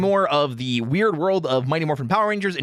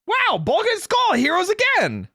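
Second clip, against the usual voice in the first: mock excited; 3 dB louder, slower than usual. Mock-heroic cheer.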